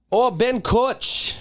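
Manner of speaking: put-on high, drawn-out Thai accent. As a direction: try a high, slow Thai voice.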